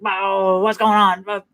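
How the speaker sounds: high, eager dog voice ready to fight. Retold or imitated. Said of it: "he was like".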